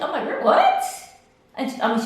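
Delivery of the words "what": loud, very high yelp; shocked. Retold or imitated. Patient hears diagnosis on the phone, shocked. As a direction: burst out in acted disbelief.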